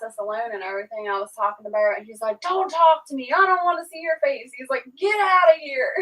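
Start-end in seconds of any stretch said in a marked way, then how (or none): 2.4-5.9 imitating a tearful shout, higher pitched; whiny furious send-off, replayed for laughs; mock angry crying yell. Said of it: "He was so mad at me".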